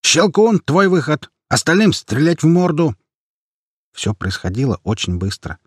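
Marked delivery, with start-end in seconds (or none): 0.0-3.0 high, urgent battle commands; shouting orders. A narrator's reading. Squad leader directs fighters at the monster, shouting. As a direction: rap out orders over the chaos. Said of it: "крикнул чех".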